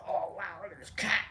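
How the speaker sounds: whispered amazement; soft, unvoiced marvelling; hushed awe. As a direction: whisper it in wonder. Awed at cat in retold trip.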